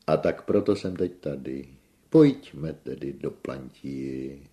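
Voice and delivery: loud, lifted theatrical storytelling; effusive tenor. A narrator's reading. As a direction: recount it all as if on stage.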